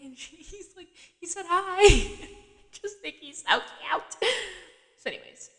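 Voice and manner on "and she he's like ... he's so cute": high, giggly squeal; giggly gushing. Real, not acted. Recapper gushing over a cute TV character, giggling. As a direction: gush through real giggles, pitched way up.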